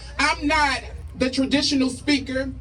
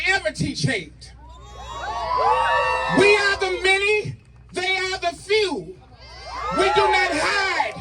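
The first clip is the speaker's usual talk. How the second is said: rally speech; 3 semitones higher than usual. Acted. Spoken word rally poem, shouted.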